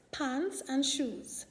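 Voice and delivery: ordinary delivery, nothing marked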